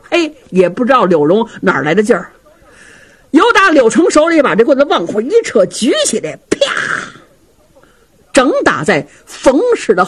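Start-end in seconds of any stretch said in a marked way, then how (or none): none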